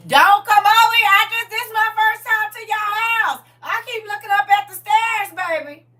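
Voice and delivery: high, loud panicked pleading; scared high yelling at the toddler; frightened yell. Retold or imitated. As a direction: shriek it at the little girl in fear. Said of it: "I said".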